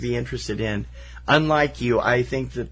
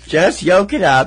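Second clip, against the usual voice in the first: loud, high, slow mock scolding; emphatic mock exasperation; 7 semitones higher, 9 dB louder, slower than usual. Co-host hammering the egg pun home, loud mock exasperation. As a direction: drag out the pun with playful force. Repeats pun with more force.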